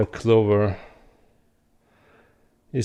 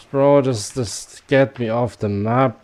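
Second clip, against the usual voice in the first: frustrated yell; 4 semitones higher, 9 dB louder, slower than usual. Real, not acted. Frustrated gamer yelling mid-game.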